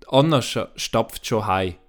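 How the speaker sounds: plain reading voice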